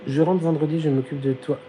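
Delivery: usual speaking voice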